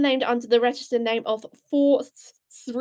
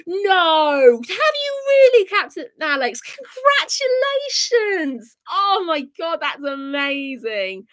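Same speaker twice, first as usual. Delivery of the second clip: excited; 3 semitones higher, 4 dB louder, slower than usual. Real, not acted. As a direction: burst out in happy amazement. Viewer reaches 200 subscribers.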